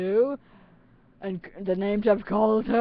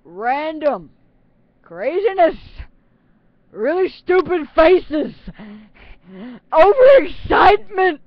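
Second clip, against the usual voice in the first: higher, louder, building hype; overexcited listing; 7 semitones higher, 8 dB louder, slower than usual. Real, not acted. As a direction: pump up each item like a crazy promo. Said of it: "things you can expect".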